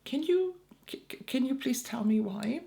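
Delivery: high, halting, restrained politeness; forced calm. Retold or imitated. Caller asking the agent why, as calmly as possible. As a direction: stammer it gently, holding back the outburst. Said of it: "as calmly as possible".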